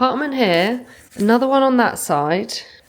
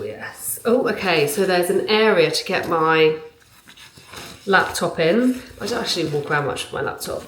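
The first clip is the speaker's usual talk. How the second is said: ordinary talk, nothing marked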